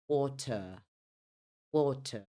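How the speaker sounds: British "water" with silent final r; non-rhotic British water, crisp t; British pronunciation. Shown as a demonstration. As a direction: say water as "WAW-tuh". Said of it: "No inglês britânico".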